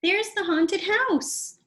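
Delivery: high, slow awed announcement; high slow awe; excited little brother. Acted. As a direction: point it out in wonder. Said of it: "Arthur said to Stanley".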